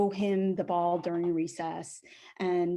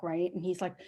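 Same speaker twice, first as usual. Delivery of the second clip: ordinary talk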